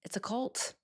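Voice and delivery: ordinary talk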